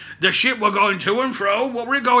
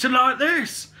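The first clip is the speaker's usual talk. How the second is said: drawn-out West Country vowels on "like this"; Somerset accent; 5 semitones higher than usual. Shown as a demonstration. Explaining his childhood move, in a Somerset accent. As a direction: show off a slow Somerset accent. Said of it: "my accent all changed".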